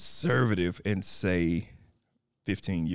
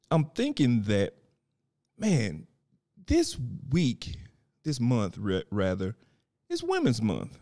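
ordinary talk, nothing marked

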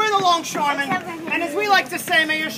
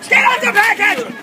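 loud, higher angry yell; angry shout; 6 semitones higher, 9 dB louder than usual. Acted. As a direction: bark it at the worker. Boss yells at worker.

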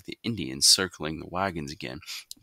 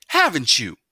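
loud, higher repeated demand; yelled question; 9 semitones higher, 8 dB louder than usual. A narrator's reading. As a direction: yell it back at him. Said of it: "Mama yelled".

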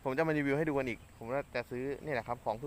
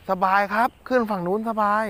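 thrilled; 8 semitones higher, 10 dB louder than usual. Real, not acted. Cast lands on far bank.

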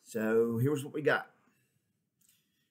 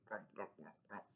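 much softer, low grumbling grunts; grumpy crab; 5 semitones lower, 20 dB quieter, faster than usual. Shown as a demonstration. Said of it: "Can you say it like Grump, Grump?".

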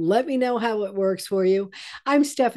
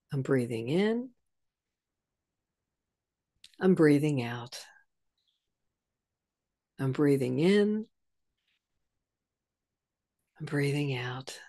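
soft, low, unhurried meditation murmur; guided breathing cue; 5 semitones lower, 5 dB quieter than usual. Shown as a demonstration. Teacher guiding viewers through the breath exercise, softly and low. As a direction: breathe with them, gently, each phrase on its own. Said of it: "letting your awareness come to your breath".

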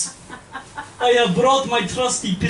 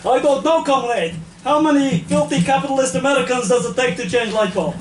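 put-on Russian accent. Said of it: "KGB comedy act".

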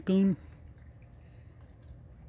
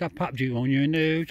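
ordinary talk, nothing marked

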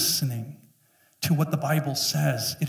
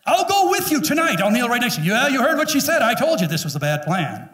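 higher, louder, laughing banter; mock cheerful; 7 semitones higher, 4 dB louder than usual. Pastor joking about kneeling beside a complainer, laughing. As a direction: tease it with a laugh.

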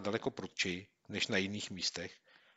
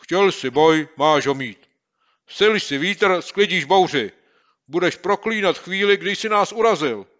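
much louder and higher, slow, vowing revenge; threatening shout; 9 semitones higher, 15 dB louder than usual. Acted. Boy shaking his fist at the bully, threatening. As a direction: threaten him with fury.

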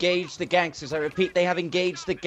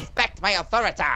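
Cartman impression; 3 semitones higher than usual.

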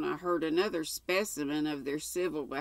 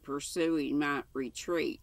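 usual speaking voice

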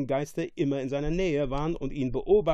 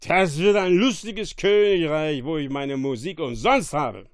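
loud, delighted exclamation; enthusiastic shout; 7 dB louder than usual. A narrator's reading. Drunkard hearing free music, enthusiastic shout. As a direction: crow over your new kingdom. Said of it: "rief Stefano begeistert".

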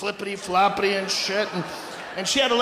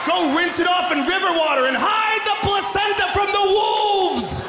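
high, projected comic tirade; mocking rant; 8 semitones higher than usual. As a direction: rant it high in mock outrage. Ranting at old-fashioned birthing.

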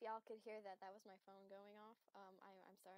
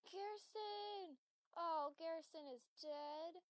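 mock grief; 6 semitones higher, 9 dB louder, slower than usual. Finding another grave.

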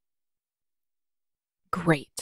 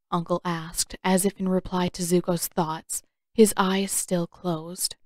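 plain reading voice

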